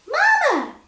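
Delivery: put-on very high, loud toddler squeal. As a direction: squeal with joy for Mama. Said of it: "she squealed".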